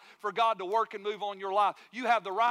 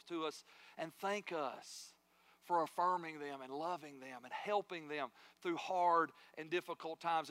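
usual speaking voice

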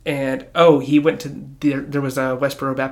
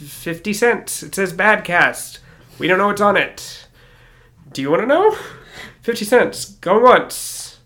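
loud, higher auctioneer calling bids; 5 semitones higher, 4 dB louder than usual. Pretend. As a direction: hawk the tape like an auctioneer. Auction bidding acted out.

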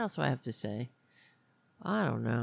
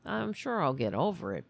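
normal talking voice

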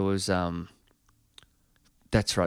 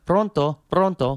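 pronto pronto, higher and louder, Italian style; busy receptionist snapping up the phone; Italian phone answering; 7 semitones higher, 5 dB louder than usual. Retold or imitated. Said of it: "The woman kept on answering the phone".

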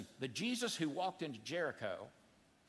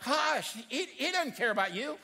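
dismissive crowd; 7 semitones higher, 4 dB louder than usual. Retold or imitated. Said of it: "The crowd in Jericho is like".

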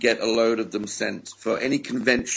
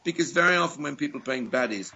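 usual speaking voice

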